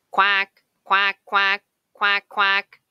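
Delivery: loud, quacking duck shout. A narrator's reading. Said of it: "shouts Dottie".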